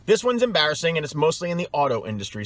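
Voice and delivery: ordinary talk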